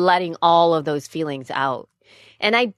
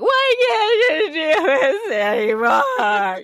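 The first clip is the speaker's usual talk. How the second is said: retold crying shout, higher and slow; crying outburst; 8 semitones higher, much slower than usual. Mother reliving yelling at her girls, crying and shouting. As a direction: wail it through tears at the kids. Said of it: "I would just start crying and bawling".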